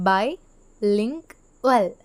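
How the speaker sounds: by, link, well, each set apart by a pause; syllables one by one. Shown as a demonstration. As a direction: split the word into by, link, well. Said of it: "എന്ന് പറയുന്ന സമയത്ത്". (by, link, well എന്ന് ഓരോ അക്ഷരവും വേറിട്ട്)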